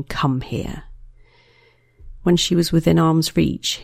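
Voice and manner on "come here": low, quiet growled command. A narrator's reading.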